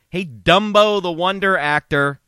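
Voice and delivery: taunting outburst at Tom Cruise; mock call-out. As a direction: yell it straight at Tom Cruise. Calling out Tom Cruise directly.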